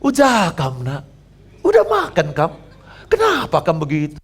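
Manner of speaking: high, swooping Karo lilt; loud, sing-song Karo accent. Acted. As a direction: imitate a Karo wife, leaping up on key words. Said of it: "suaranya kayak orang Karo".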